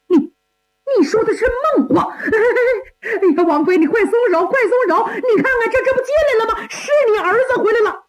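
高声尖叫，喊疼又带笑; 手腕被攥疼，连声喊松手; 疼得直叫的嘉靖. Acted. 嘉靖被抓手腕疼得叫. (被王妃攥住手腕，疼得尖声喊叫)

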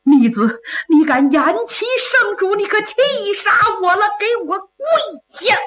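high, shaken scolding of a reckless son; a frightened father snapping at his son to kneel; trembling with fright. Acted. Father terrified before the emperor, high shaken scold. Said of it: "吓得直哆嗦".

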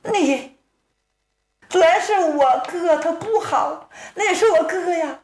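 put-on a high, tearful young woman's voice; high, half-crying protest; pleading girl. Sister defending her brother to the hero, high and tearful.

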